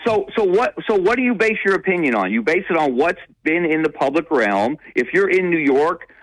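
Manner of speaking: fired-up, challenging the critics; worked up, pushing back on critics of his column; heated rise. Real, not acted. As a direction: pitch jumps way up, pressing his point hard.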